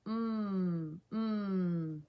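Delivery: "Mm" as a long, soft closed-lip hum; letter-sound drill. Shown as a demonstration. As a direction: hum each "Mm" steadily, lips together. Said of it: "Missy Mouse says".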